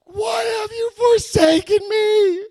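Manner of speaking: huge drawn-out anguished scream, very high; high, slow, desperate howl to the heavens; screaming at top of lungs. Retold or imitated. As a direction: cry out to God at full volume. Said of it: "I screamed at the top of my lungs".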